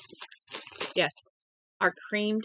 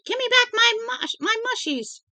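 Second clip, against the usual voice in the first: shrill, much higher shriek of a woman; screaming lady; 5 dB louder than usual. A narrator's reading. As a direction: scream it, desperate and high. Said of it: "And the lady screamed".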